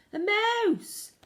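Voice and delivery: high, loud alarmed shout. Acted. Cooks spotting the fleeing mouse, shouting high. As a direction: cry out in alarm. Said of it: "they were shouting".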